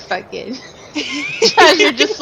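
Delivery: ordinary talk, nothing marked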